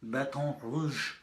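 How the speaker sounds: slow, put-on French accent on Baton Rouge; French-style accent. Shown as a demonstration. Poet showing off a practised accent to the audience, mock French. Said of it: "working on that accent all week".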